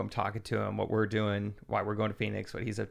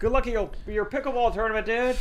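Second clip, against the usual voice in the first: raised high replay of a shout; high mock yell; 10 semitones higher than usual. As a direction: call it out again, high. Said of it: "Just shouted on an airplane".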